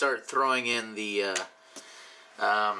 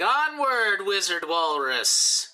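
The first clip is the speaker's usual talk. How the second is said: battle cry; 5 semitones higher, slower than usual. Acted. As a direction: cry out like a warrior leading the charge. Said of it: "he's gonna be yelling".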